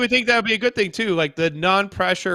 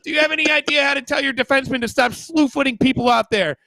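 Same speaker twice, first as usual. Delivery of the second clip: mock insulting reporter; 8 semitones higher than usual. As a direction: sneer at the coach as a hostile reporter.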